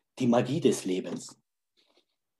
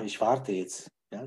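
usual speaking voice

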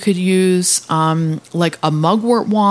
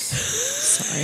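teary sorry; 9 dB quieter than usual. Real, not acted. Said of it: "she really is crying".

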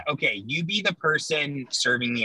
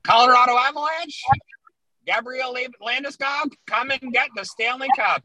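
announcer voice; 10 semitones higher than usual. Shown as a demonstration. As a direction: announce it like a hockey commissioner.

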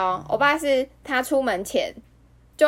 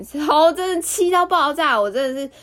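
real anger; 5 semitones higher, 4 dB louder than usual. Real, not acted. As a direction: let the anger burst out. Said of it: "有，有气愤".

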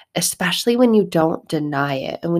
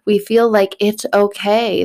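ordinary talk

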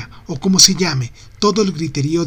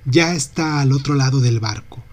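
plain reading voice

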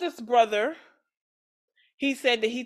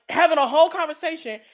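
ordinary talk, nothing marked

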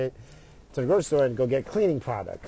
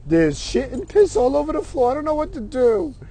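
high-pitched, climbing mock fluster; imitating embarrassed son; 8 semitones higher than usual. Son calling his parents about the clogged toilet, embarrassed and flustered. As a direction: play a helpless kid, voice rising higher. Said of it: "And he was embarrassed".

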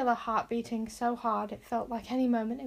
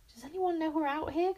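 cried out; 6 semitones higher than usual. A narrator's reading. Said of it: "cried Bodkin".

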